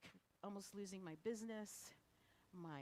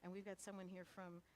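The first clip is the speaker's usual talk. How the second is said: usual speaking voice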